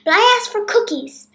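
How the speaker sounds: high, loud protest; complaining princess. A narrator's reading. Princess complains about the gift.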